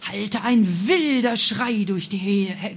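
slow, drawn-out, forceful storytelling; wild dramatic outburst. A narrator's reading. Storyteller as the witch's scream rings out, dramatic outburst. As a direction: stretch the words out dramatically.

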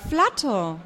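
happy exclamation; joyful cry. A narrator's reading. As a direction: glad relief at seeing him. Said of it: "Erfreut richtet sich Roderick auf".

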